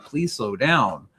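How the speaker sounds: drawn-out slow plea; pleading slow down. Retold or imitated. Tired guests begging the rushing investigators, slow. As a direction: stretch every word like a tired guide. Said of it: "everybody's like".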